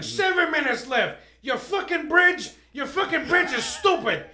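high, loud mocking tirade; comic roasting the Philly crowd, loud rant; Bill Burr. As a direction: tear into the crowd, loudly. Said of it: "He's like".

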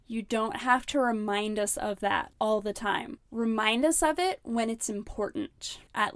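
ordinary delivery

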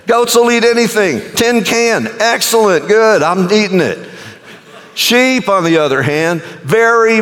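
high-pitched, rollicking sermon delivery; animated comic preaching. Real, not acted. Preacher jokes to congregation about goats and sheep, animated comic preaching. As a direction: play the sheep facts up for laughs, pitched high.

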